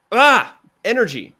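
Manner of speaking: a quick burst of energy; energetic burst. Shown as a demonstration. Coach showing a pitcher an energetic line. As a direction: pop it with energy. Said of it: "what's something that you could be like".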